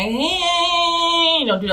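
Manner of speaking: long high sing-out; drawn-out, high-pitched hey; awkward dodge. Acted. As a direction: stretch it up high while wriggling away. Shimmying out from under his arm.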